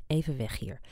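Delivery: ordinary delivery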